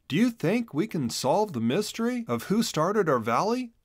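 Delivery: a hint of a hopeful boy asking; young Chisel. A narrator's reading. Chisel voiced.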